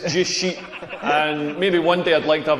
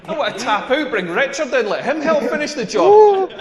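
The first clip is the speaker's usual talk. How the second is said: mock-desperate, climbing higher and louder; exasperated plea; 6 semitones higher, 3 dB louder than usual. Worn-out lover wanting to tap out, exasperated. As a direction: build to a pleading finish. Exhausted protest, begging for relief.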